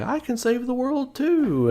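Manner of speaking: high, held-up little toy voice; cute high-pitched imitation of the toy; childlike toy voice. Mimicking the little toy promising to save the world, cutesy high voice. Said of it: "and he's like".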